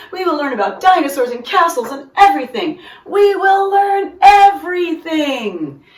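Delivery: high, loud, gushing principal voice; a proud principal carried away with delight; excited principal. A narrator's reading. Mister King's excitement.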